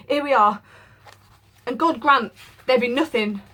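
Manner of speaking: harsh servant. Acted. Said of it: "his voice harsh and broken".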